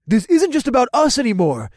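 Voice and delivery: high, loud impassioned yell. A narrator's reading. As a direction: keep shouting, pleading hard. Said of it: "Boyd yelled".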